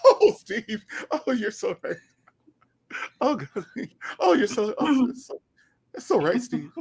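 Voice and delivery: high, swooning mock co-host; Shirley Strawberry impression. Co-host gushing over the host, high mock swoon. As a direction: coo his name like an adoring fan. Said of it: "And Shirley be".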